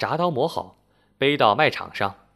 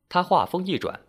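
ordinary delivery, nothing marked

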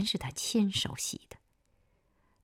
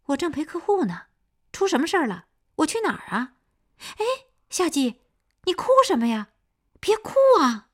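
alarmed on the phone; 10 semitones higher, 8 dB louder than usual. A narrator's reading. Sister hears crying on phone.

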